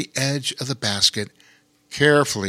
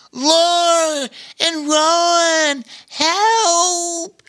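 much higher, louder, slow desperate cry; an otter screaming to the boys for rescue; otter yelling for help; 4 dB louder, much slower than usual. Acted. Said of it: "yelled the otter".

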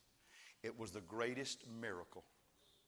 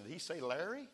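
lightly acted puzzled question, pitched up at the end; imagined hopeful questioner; 6 semitones higher than usual. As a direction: ask it like someone unsure whose name was called. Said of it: "there's some guys down there said".

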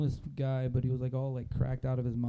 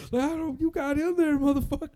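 hillbilly; 13 semitones higher, 8 dB louder than usual. Retold or imitated. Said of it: "hillbilly answers the bum".

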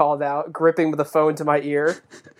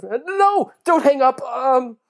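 high, strained plea; groaned plea; 9 semitones higher, much slower than usual. Acted. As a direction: plead urgently, voice pitched way up. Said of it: "he groaned out".

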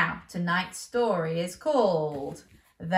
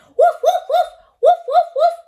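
high, loud playful woofs with a laugh; dog barking; 15 dB louder than usual. Acted. Storyteller barks as Ben the sheepdog, loud and playful. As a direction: bark like a happy puppy. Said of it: "He barked like a dog".

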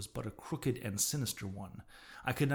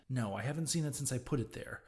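ordinary delivery

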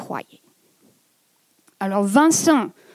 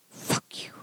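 a furious whispered curse, much softer; whispered rage; 15 dB quieter than usual. Real, not acted. At fate, cursing in rage in a whisper. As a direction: spit it out under your breath in anger. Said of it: "je ressens de la rage".

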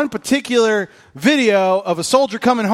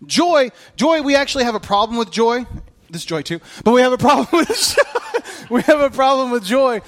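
emphatic preaching; 4 semitones higher, 3 dB louder than usual. Acted. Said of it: "preaching emphatically".

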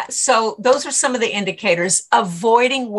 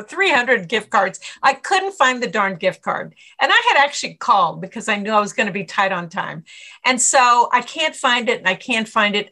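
ordinary delivery

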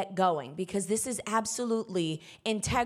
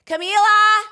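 full high shout of the name; yelling; 12 semitones higher, 12 dB louder than usual. Retold or imitated. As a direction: yell her name to get an answer. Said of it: "Until I am quite literally yelling".

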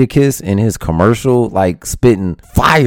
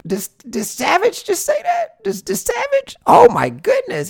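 amazed listener; 3 dB quieter than usual. Retold or imitated. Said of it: "you'll rewind and be like".

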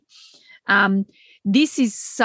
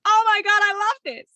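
high, gushing squeal of delight; breathless high-pitched excitement; 12 semitones higher than usual. Retold or imitated. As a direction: squeal it like you just got thrilled. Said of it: "I'm like".

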